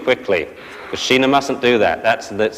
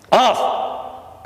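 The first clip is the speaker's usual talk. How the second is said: a sharp high barked "Off!"; sharp command; 11 semitones higher than usual. Shown as a demonstration. Trainer barks off at the puppy, sharp command. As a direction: snap the command at the dog. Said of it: "So we say".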